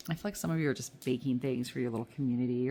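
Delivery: normal talking voice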